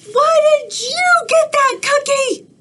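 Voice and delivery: a furious cartoon bird; outraged pigeon voice; angry pigeon.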